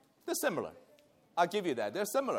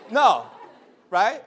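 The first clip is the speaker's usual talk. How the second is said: ordinary delivery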